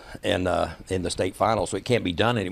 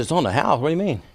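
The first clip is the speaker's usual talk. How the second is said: questioning repeat, climbing higher at the end; puzzled echo; 5 semitones higher, faster than usual. Retold or imitated. Wrestler retells questioning the concession girl, puzzled. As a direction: query it, mildly taken aback. He questions the girl's statement back.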